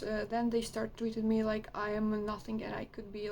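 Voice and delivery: ordinary delivery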